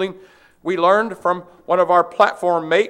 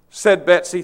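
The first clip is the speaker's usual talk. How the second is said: ordinary talk, nothing marked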